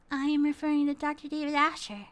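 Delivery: high, cute little creature voice; high-pitched baby creature. Acted. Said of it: "Little baby creature?".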